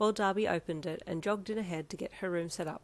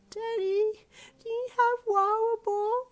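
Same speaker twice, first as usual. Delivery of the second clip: put-on high, slow sleepy toddler; tired little voice; 6 dB louder, slower than usual. A narrator's reading. Toddler asking Daddy on the phone, tired and high. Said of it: "very tired little voice".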